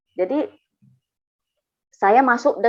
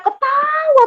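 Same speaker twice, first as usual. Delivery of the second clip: "ketawa" stretched high on its middle syllable; drawn-out KETAWA; 4 dB louder, slower than usual. Retold or imitated. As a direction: hold the "ta" of "ketawa" long and high. Friend's laughter, drawn out emphatically.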